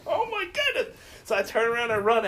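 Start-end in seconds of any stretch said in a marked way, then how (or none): 0.1-1.0 pitched way up, startled gasp of a line; mock horror at walking into the wrong room; retold shock. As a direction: squeak it out like she just caught herself. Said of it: "And I'm like".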